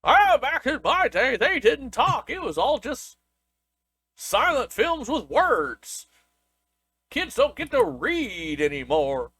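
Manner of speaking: put-on high creaky old-man voice, slowing down; cranky old-timer. Co-host parodies an old-timer about silent films, high and slow. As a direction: grumble like a grandpa about the old days.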